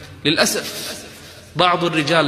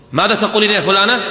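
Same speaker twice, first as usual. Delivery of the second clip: higher, louder angry father shouting; 6 semitones higher, 5 dB louder than usual. Retold or imitated. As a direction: storm in and demand it furiously. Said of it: "مغضبا". (الأب يدخل غاضبا ويصرخ)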